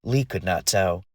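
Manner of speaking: usual speaking voice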